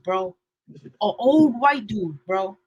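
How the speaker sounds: normal talking voice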